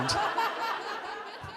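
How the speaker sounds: a sudden squeaky laugh at his own joke; real laugh breaking through; high laugh. Real, not acted. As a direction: let out a high giggle. Laugh after punchline.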